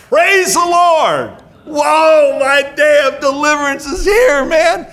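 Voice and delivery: high, loud hallelujah shout; hammed-up cry of praise, stretched out high; mock jubilation. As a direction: whoop it up like an overjoyed convert. Imagined jubilant reaction to healing.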